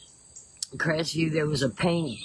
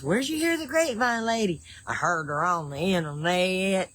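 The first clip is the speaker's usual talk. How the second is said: Southern drawl catchphrase. Shown as a demonstration. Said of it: "say it just like that".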